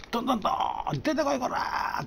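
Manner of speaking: retold furious yell; angry shout. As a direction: pound the door and demand he come out. Friend bangs on door shouting.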